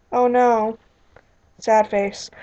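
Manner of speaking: ordinary delivery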